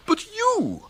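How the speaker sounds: high, slow contempt; contemptuous. Acted. Gorringe sneering at Bertie as a weedy butterfly. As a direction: drag out the insult with disdain.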